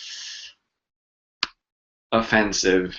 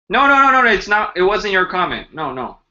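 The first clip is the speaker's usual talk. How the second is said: flustered denial; 7 semitones higher than usual. Real, not acted. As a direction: quickly reassure the viewer it wasn't them. Hastily reassuring a commenter.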